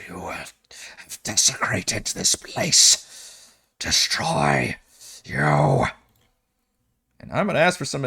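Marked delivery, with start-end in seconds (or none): 0.1-3.5 slow unvoiced ghostly whisper; unearthly voice. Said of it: "A ghostly voice emanating". 3.8-5.9 low, menacing ghost voice; unearthly voice. Acted. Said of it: "A ghostly voice emanating".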